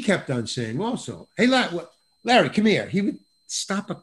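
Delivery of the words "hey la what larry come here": lightly voiced director calling an actor over. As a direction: call him over, higher and a bit louder. Director calls actor over mid-scene.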